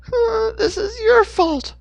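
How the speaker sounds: very high, loud, slow crying accusation; whimpering accusation. Acted. Hannah accuses Dixon while whimpering, crying. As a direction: sob it out, blaming him. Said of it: "she accused him".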